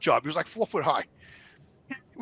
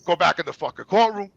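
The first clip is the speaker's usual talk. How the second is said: ordinary delivery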